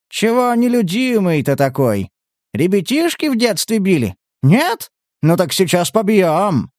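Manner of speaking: high, teasing bully's singsong; mocking taunt. A narrator's reading. Collector bullying the prisoner, mocking taunt.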